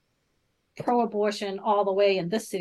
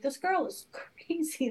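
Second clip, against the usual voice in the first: whispered inner thought; 6 semitones higher, 6 dB quieter, slower than usual. Retold or imitated. Inner thought mocking friend.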